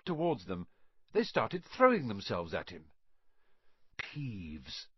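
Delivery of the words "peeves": hushed whisper of a warning name. A narrator's reading. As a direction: breathe the name almost silently. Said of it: "Percy whispered to the first years".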